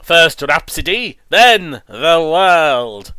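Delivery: slow, drawn-out evil villain. Retold or imitated. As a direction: declare it like a cartoon devil. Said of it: "That's exactly what he sounds like".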